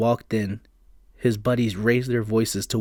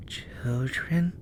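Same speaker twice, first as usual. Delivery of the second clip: eerie soft question; 3 semitones higher, 5 dB quieter than usual. Acted. Spirit asks.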